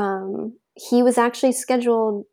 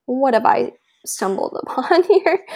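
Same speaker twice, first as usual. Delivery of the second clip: higher, breaking into a laugh; amused mock surprise; 4 semitones higher, 4 dB louder than usual. Husband finding her on all fours, laughingly voiced. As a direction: laugh through the retold question.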